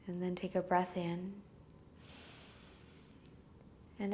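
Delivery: soft, spacious breath-counting voice with long pauses; guided meditation. Real, not acted. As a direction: lull them gently toward sleep, leaving space to breathe. Leads breath count and body scan.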